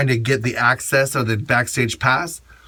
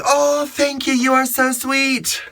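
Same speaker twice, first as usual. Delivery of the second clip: much higher, slow, sweet fan-friendly voice; 10 semitones higher than usual. Retold or imitated. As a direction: play the gracious star who warms to the fan. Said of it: "Or she's like".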